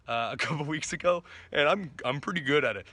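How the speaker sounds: ordinary delivery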